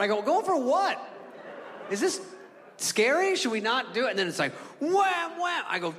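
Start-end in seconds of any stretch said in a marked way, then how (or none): 0.2-4.1 high, jittery questions with nervous laughs; panicky high voice. Retold or imitated. Nervous passenger asking his buddy if they should land, high and jittery. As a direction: fret about going for it, voice climbing.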